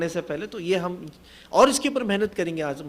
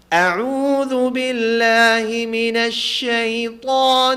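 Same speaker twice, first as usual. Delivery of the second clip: slow, drawn-out chanted tilawat, louder; Quran recitation; 2 semitones higher, 5 dB louder, much slower than usual. Shown as a demonstration. Teacher reciting to class, slow chanted tilawat. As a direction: chant each word long and steady.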